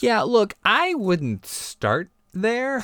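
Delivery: high, very slow warning. Real, not acted. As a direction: drag out the warning. Comic warning, slowed way down.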